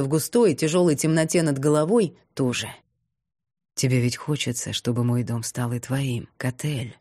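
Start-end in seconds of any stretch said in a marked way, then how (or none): 3.7-7.0 low, soft insinuating whisper; tempting devilish whisper. A narrator's reading. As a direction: coax her quietly, sly and low. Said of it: "Вкрадчивый шёпот".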